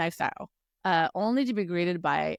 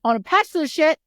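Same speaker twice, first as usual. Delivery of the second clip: loud, high, insistent demand; hammed-up indignant ultimatum; mock demanding; 8 semitones higher, 7 dB louder than usual. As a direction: demand it loudly, punching each phrase. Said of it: "He goes".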